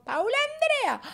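mock telling-off by full name; scolding parent. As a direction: imitate a parent calling a child out. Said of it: "los papás cuando le dicen a uno el nombre completo".

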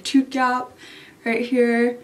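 lip pulled aside with finger; talking while showing teeth; finger pulling lip. Real, not acted. Seen 0: Finger hooks lower lip aside showing braces during "right here.

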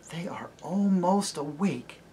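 slow breathy whisper of waking trees; dreamy tree whisper. Acted. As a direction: hush it like sleepy trees. Trees murmuring as they wake.

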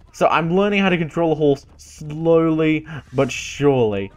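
ordinary talk

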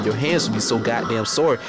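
ordinary delivery

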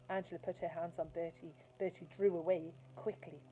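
plain reading voice

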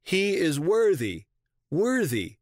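high, drawn-out narrated cry; shouted acclamation. A narrator's reading. Eastern bishops acclaiming Theodoret, high shouted acclamation. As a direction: call it out slowly, pitched well up. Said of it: "shouted out".

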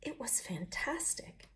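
slow, hushed and enchanted; storyteller savouring the scent in a whisper; dreamy whisper. A narrator's reading. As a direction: breathe it out in wonder. Pig savouring the flower scent.

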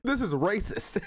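high, laughing mock protest; laughing imitation. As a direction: repeat it through laughter.

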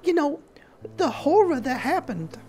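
high, warm middle-aged woman, grateful; thanking the group after the crash, high and heartfelt; Alice character voice. Acted.

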